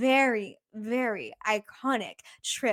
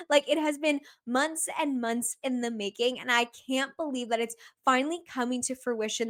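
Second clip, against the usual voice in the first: usual speaking voice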